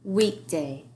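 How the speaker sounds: Weekday said as one word for tapping; teacher modelling Weekday; whole word then split. Shown as a demonstration. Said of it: "Let's start with weekday".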